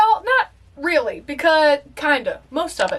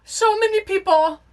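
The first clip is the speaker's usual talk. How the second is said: ordinary talk, nothing marked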